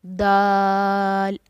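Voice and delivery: दाल said thin and slow, tongue tip on teeth; thin letter demo. Shown as a demonstration. Teacher demonstrating a thin, drawn-out दाल. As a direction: stretch दाल out thin and light. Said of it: "बारीक आवाज़ में पढ़ना है".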